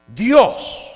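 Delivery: loud, drawn-out call to God; pompous, high-pitched invocation; booming Pharisee. Acted. Pharisee praying aloud in the temple for all to hear, booming. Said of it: "yo ese tono".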